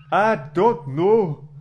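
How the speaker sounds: a Welshman with a bad feeling; brooding Welsh accent. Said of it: "your ridiculously accented utterance".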